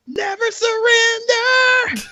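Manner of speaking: drawn-out high heroic battle cry; hammed-up defiant yell, stretched out long; high slow mock shout. Movie hero shouting at the lights, drawn out and high. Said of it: "looks at the lights".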